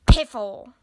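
loud, dismissive outburst; grumpy shout. A narrator's reading. Grumpy Badger refuses Rabbit's plea, shouting. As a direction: throw the word out crossly. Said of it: "shouted Grumpy Badger".

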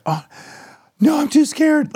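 mock-frightened girl, squeaking high; scared squeal. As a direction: squeal it like a nervous kid too scared to go. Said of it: "And she was like".

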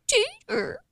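put-on high, breathy girly baby talk. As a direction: coo his name like a little girl. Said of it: "you've had the baby voice, girl".

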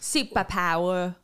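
Australian accent. Shown as a demonstration. Host answers request for accent, slow Australian. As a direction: stretch it out in Australian, dropping the r. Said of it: "That's Australian".

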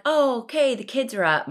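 imitating her own chipper morning self; mock cheer. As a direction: sell the false optimism. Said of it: "so I'm like".

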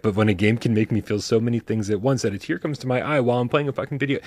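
usual speaking voice

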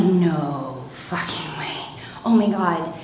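slow, hushed disbelief; dreading whisper. Retold or imitated. Dread on seeing the truck.

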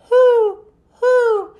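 high, loud owl hoots. Acted. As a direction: hoot like an owl, high and spaced. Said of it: "sound like an owl".

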